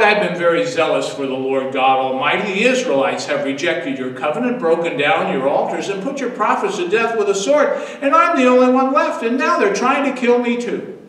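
a frightened prophet insisting he is the only one left; building desperate complaint. Acted. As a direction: let the grievance swell into a plea. Elijah repeats his complaint.

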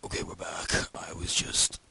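voiceless throaty put-on voice; strained, toneless silly voice; throat-straining voice. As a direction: force it out through the throat with no tone. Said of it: "I'm not gonna talk like that".

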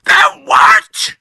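very high, loud terrified cry; scream. A narrator's reading. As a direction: shriek in horror. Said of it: "screamed Missus Twit".

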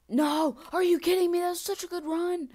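loud, high outburst at the failed run; frustrated yell. Real, not acted. Gamer yelling at his screen after a fall. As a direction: shout it out, real disbelief. Fail in a parkour game.

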